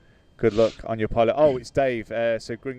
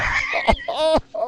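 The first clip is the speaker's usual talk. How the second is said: a sudden high burst of laughter; high shrieking laugh at a chat joke; loud laugh; 4 dB louder than usual. Real, not acted. Reacting to a funny chat message.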